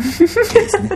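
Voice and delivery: high laughing word. Real, not acted. Correcting face to faith, laughing high. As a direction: laugh the pun out.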